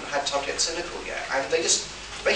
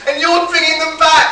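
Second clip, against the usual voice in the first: much higher and louder, thrilled; excited exclamation; 12 dB louder than usual. Retold or imitated. Producers cheering the Daleks' return, excited shout. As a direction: shout it with joyful disbelief. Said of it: "they stood up and said".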